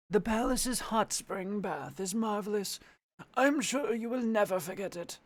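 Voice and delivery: put-on old man voice, high and far from her own. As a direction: play a frail old chamberlain. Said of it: "my Old Man voice".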